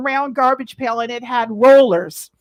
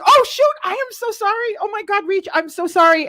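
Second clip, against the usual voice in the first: flustered apology; 7 semitones higher than usual. Retold or imitated. Walked in on undressing boss.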